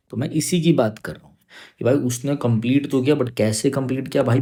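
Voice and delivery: normal talking voice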